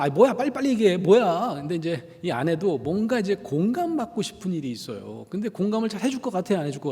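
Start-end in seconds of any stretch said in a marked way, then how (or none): 0.0-1.5 loud, rushed and pitched up; impatient husband. Retold or imitated. Husband pushing his hesitant wife to speak before work, loud and impatient. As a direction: hurry her up, annoyed.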